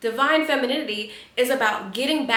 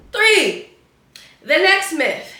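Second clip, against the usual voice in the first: loud announcement; 6 semitones higher, 8 dB louder, slower than usual. Real, not acted. Announces next myth loudly.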